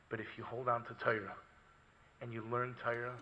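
low, very soft sermon voice; grave hush over the congregation; hushed preaching. Acted. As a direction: preach it quietly and low, pausing between phrases.

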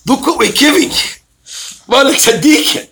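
high-pitched mock marvelling; imitated admiration. Children praising their fathers for giving them the business, mock-admiring.

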